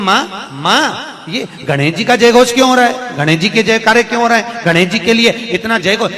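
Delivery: loud, high protest to a mother; aggrieved son calling out to his mother; indignant questioning. Acted.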